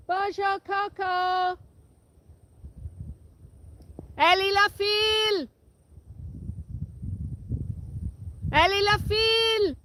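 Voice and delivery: high cow call. Real, not acted. Farmer calling her cows in French across the wind. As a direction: sing it out to the herd. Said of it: "let's call 'em".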